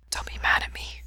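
soft, pleading whisper. A narrator's reading. Lover pleading after a confession, whispered. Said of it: "Ray whispers".

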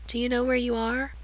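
put-on solemn girl questioning the lost boy, very soft. A narrator's reading. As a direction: ask him gently. Solemn girl asks Gerard.

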